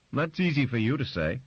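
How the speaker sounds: high, loud resentful jab; bitter retort. Acted. Grace retorting bitterly to Barbara, high and loud. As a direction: fling it back at her.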